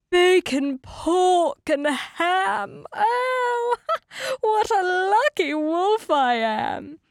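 high, gloating wolf cry; exultant shout. A narrator's reading. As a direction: crow loudly over the feast. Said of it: "He shouted".

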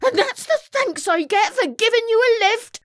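high-pitched indignant outburst; fuming. A narrator's reading. Violet gets no thanks for a lift, fuming. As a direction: fume at the ingratitude, voice shooting up. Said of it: "fumed Violet".

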